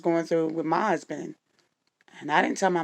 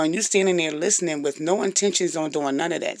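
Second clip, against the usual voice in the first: normal talking voice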